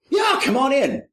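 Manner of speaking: high, loud, bright welcome shouted out; loud invite. Retold or imitated. Perplexed man with gun shouts him inside, loudly. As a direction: belt it like a dazed host.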